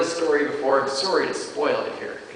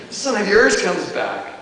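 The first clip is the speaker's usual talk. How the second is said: a bit higher and louder, leaning on "yours"; bitter older brother; 6 semitones higher, 5 dB louder than usual. Acted. Older brother resents his father's welcome. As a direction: make "yours" sting. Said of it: "You love the bitterness there".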